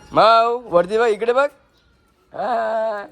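high coaxing call to the cat; high pet talk while filming the cat. Real, not acted. Calling the cat to look up.

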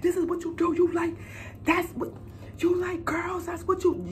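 high, wailing, half-sobbing accusations; mom's crying rant. Retold or imitated. Mother confronting her daughter, high crying rant. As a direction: rant like a mother who just found out. Mom yelling, retold.

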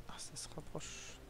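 hushed unvoiced whisper while sneaking. Real, not acted. Sneaking past guards in a forbidden zone, whispering. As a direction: whisper so the guards won't hear.

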